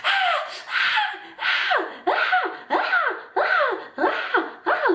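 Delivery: high breathy mock screams on each step; retold screaming. Squealing at every step through the dirty water. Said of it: "like, screaming".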